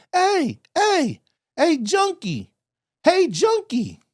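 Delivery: hammed-up holler of junkie; mock yell. Imitating the man yelling across the street, high mock holler. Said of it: "I can hear somebody yelling".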